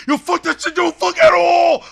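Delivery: high, loud mock studio yell; mock shout. Imitating rappers yelling in the studio, loud mock shout. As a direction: shout it like a heated rapper in the booth.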